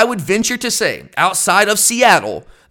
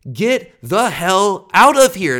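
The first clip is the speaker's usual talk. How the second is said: slow, emphatic outburst; outraged; 3 semitones higher, 4 dB louder, much slower than usual. Real, not acted. Fan dismissing the committee's twenty-four-hour reversal, outraged. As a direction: punch each word in exasperation, dragged out.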